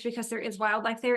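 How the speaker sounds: usual speaking voice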